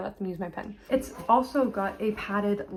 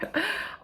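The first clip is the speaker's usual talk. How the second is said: a short high-pitched giggle; 12 semitones higher than usual. Real, not acted. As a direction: giggle at yourself. Laughs at own packing.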